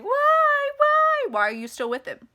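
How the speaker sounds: high, loud wail, then dropping to plain; crying out. Acted. Acting out nana's tearful reaction to the letter, crying. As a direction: wail why as if in tears. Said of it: "my Nana would have been crying".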